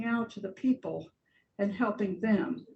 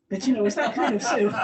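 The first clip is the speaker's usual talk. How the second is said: quick, louder, laughing through the words; real chuckle breaking into the line; amused laughter; 5 dB louder, much faster than usual. Real, not acted. Laughing at her own imitation.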